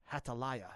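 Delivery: "Hathaliah" said the Hebrew way, with an h sound at the start; Hebrew pronunciation. Shown as a demonstration. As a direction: say "Hathaliah" as Hebrew speakers do, opening on a soft h. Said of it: "Now, the Hebrew pronunciation".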